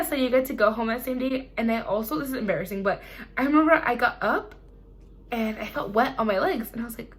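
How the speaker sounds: ordinary talk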